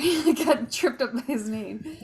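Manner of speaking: laughing through an embarrassed apology. Real, not acted. Player laughing at her own slip. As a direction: giggle at tripping over a name.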